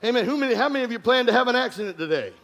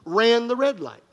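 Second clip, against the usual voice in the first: ordinary talk, nothing marked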